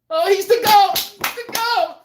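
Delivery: sarcastic fanboy, pitched far up; high, mocking praise; mock worship. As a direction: parrot the fans who call him the greatest.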